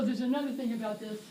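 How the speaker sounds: ordinary talk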